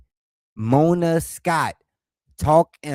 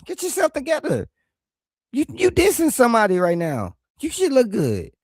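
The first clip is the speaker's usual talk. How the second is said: high, fussy scolding; a hint of a nagging mother; fussing mom voice; 9 semitones higher than usual. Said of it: "I've been like".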